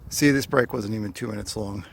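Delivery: ordinary talk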